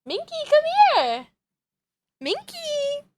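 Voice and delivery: very high pet-calling voice; calling a cat. Real, not acted. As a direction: coo the cat's name way up high. Calling cat from across room.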